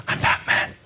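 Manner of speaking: gravelly whispered "I'm Batman". Retold or imitated. Answering a girl who whispered to him, gravel Batman growl. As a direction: growl it like the Dark Knight. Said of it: "I looked her right in the eyes and I said".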